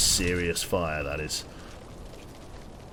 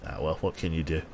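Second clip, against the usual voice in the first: ordinary delivery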